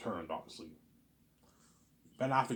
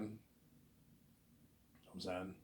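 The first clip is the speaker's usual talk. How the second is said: ordinary talk, nothing marked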